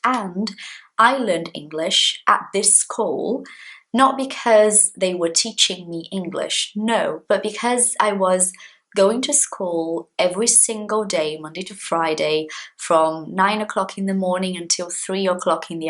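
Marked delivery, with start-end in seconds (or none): none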